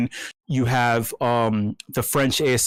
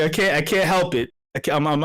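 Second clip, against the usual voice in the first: ordinary talk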